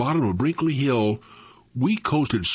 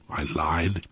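usual speaking voice

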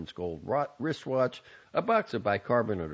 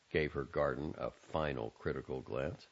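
ordinary talk, nothing marked

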